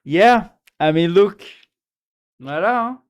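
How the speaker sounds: loud, high triumphant exclamation; excited. Real, not acted. Showing the politician's AI speech clip, loud glee. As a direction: show it off with glee.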